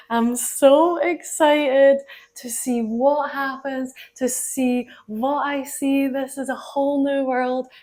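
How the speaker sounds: breathless awe at a new world; dreamy excitement. Retold or imitated. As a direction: relive the thrill of arriving. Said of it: "sitting on the train thinking".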